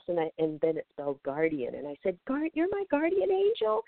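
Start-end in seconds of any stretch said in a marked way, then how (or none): 2.3-3.8 very high squealing gasp of wonder; ecstatic disbelief. Retold or imitated. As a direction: shriek it like the best news of your life. Spirit says it is guardian.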